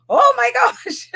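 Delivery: high, laughing oh my gosh; shocked laugh. Retold or imitated. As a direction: burst out in a startled laugh. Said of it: "and it was like".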